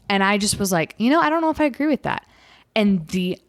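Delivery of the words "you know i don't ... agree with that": casual disagreement. Retold or imitated. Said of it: "And I said it just like that".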